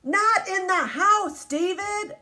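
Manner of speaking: high, loud slow scolding; stern mom. Acted. Teacher reading the mom who forbids ball in the house, high slow scold. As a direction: stop him cold, slow and high.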